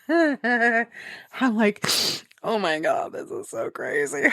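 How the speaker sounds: half-laughing, half-crying, high at the end; tearful laughing. Retold or imitated. Gamer crying at the opening story, laughing through tears.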